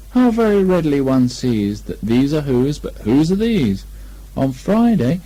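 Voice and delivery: lightly sung-song recital; poem recital. A narrator's reading. Pooh recites his verse to Kanga, mild sing-song. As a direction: recite it with a bear's earnest rhythm.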